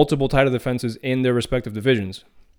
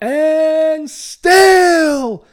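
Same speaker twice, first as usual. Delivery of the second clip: high, drawn-out ring announcer bellow; Bruce's announcer yell; 9 dB louder than usual. Retold or imitated. Host mimics the announcer declaring the champion retains, yelling it out. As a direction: belt it out like a fight announcer. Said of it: "Bruce was yelling out".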